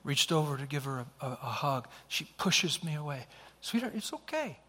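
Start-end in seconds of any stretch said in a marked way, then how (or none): 3.6-4.6 soft, high, soothing voice; hushed fatherly comfort, pitched high; tender reassurance. Retold or imitated. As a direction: gently reassure a child who pulls away. Father soothes daughter who pushed away.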